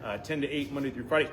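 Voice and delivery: ordinary delivery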